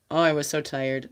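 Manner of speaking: ordinary delivery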